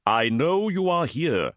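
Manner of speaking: high, slow, ringing call; commanding warrior cry. Acted. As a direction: call out to the hidden foe. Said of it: "cried Motep".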